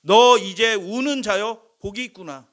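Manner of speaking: slow, loud ringing proclamation of blessing; preacher declaims Jesus' blessing to the hungry, slow and loud; Jesus proclaiming. Acted. Preacher voicing Jesus to the congregation, slow ringing proclamation. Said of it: "하나님이신 분이 약속하신".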